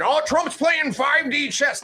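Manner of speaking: high, slow mock voice of a gullible fan. Host mocking Trump fans online, high slow sneer. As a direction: ham up the naive believer, drawn out. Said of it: "all you morons out there thinking".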